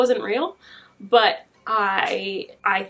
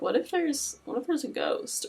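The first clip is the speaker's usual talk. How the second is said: whispered, pitched up in mock fright; mock scared whisper; 6 semitones higher, 6 dB quieter than usual. Host recalling night pee breaks, mock frightened whisper. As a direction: hush it like a spooked kid in the dark.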